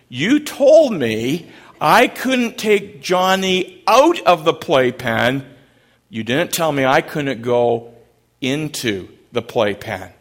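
a grandpa's sly answer to his daughter, said slow and loud; grandpa's comeback. Retold or imitated. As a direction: deliver the punchline slow and loud, a bit pleased with himself.

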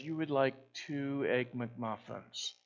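put-on a kind server repeating the order in a French accent; gentle Québécois accent, smiling. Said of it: "with that beautiful French accent".

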